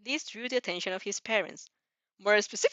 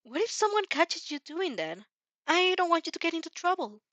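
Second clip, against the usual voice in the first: worried, higher voice; 8 semitones higher than usual. Acted. Worried Izuku.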